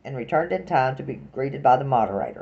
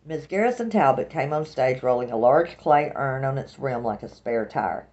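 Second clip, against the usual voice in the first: usual speaking voice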